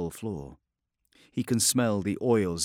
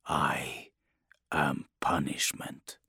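lower, soft and slow; low menacing hush; 7 semitones lower, 6 dB quieter, slower than usual. Acted. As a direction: drop it to a dark murmur. Dark hushed declaration.

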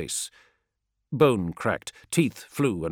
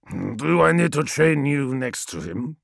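menacing wolf warrior, louder; gruff threat; 7 semitones higher, 6 dB louder than usual. Acted. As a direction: threaten him like a hardened warrior. Biaki threatens Menkora.

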